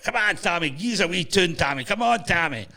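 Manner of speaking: a hint of boozy mates begging for a tune; mates cheering him on. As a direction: egg him on like a rowdy drinking buddy. Drunk mates urging Tommy to play.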